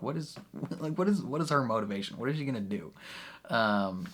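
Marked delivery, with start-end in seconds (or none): 0.0-2.9 confused quoting self. Retold or imitated. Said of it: "I'm like".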